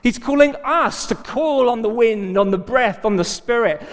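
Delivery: high, driving preacher's exhortation; rally the congregation with mounting urgency; impassioned preaching. Real, not acted. Preacher calling the church to share in remaking, impassioned.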